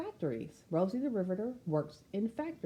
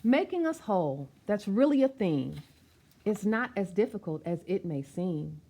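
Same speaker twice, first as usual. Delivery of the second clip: rhythmic, sing-song read of a picture-book poem; spoken-word storybook delivery; rhyming verse reading; 2 semitones higher, 5 dB louder than usual. Acted. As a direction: recite it with a steady bouncing beat.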